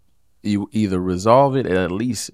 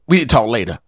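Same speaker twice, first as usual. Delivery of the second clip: curt brush-off; 5 semitones higher, 6 dB louder, faster than usual. Shown as a demonstration. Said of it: "Not say".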